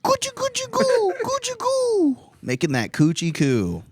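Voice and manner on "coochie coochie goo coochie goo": put-on squeaky high baby talk. To the puppet, cooing in squeaky baby talk. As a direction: coo it in a tiny high voice.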